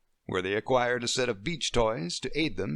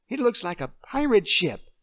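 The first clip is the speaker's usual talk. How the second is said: put-on higher and louder, thrilled child; a child spotting a pirate ship; excited little girl; 11 semitones higher, 6 dB louder than usual. Emily spotting a pirate ship, thrilled.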